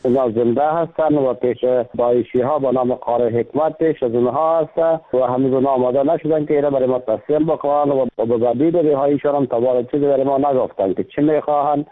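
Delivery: usual speaking voice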